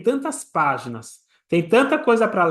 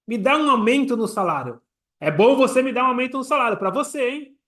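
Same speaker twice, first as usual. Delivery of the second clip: higher-pitched, pushy pitch to the boss; demanding employee; 6 semitones higher than usual. Acted. Employee asking the boss for a raise, pushy and high. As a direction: put the demand across insistently, voice lifted. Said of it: "um funcionário que chega pro patrão e fala".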